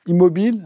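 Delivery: defining the term for students, stressed; emphatic gloss. Shown as a demonstration. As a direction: push hard on immobile. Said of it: "Tingé si- signifie".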